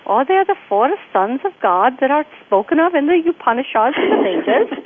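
sing-song imitation Indian accent. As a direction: play the Indian friend explaining, accent and all. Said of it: "Great Indian accent".

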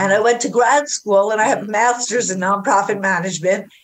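normal talking voice

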